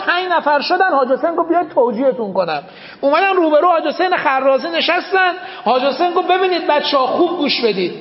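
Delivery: loud, driving rowzeh preaching; full-force preaching. Acted. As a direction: thunder the commander's orders at the crowd. Preaches the commander's orders loudly.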